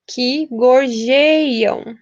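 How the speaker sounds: very slow, syllable-by-syllable "gorjeiam"; escansão bem lenta. Shown as a demonstration. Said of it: "as aves que a". ("gorjeiam" bem devagar, sílaba por sílaba)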